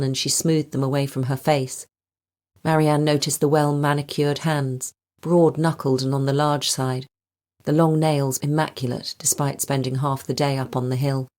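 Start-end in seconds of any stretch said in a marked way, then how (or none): none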